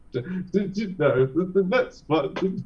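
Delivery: lightly put-on, stammering presenter voice; Dennis Norden. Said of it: "that was my Dennis Norden impression".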